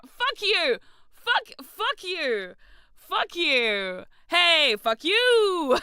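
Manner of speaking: high, loud mock outrage; playful yelling. Real, not acted. Streamer yelling back at a chat request for the mirror. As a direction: bark it at them jokingly.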